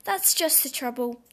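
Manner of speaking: plain reading voice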